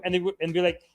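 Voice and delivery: ordinary delivery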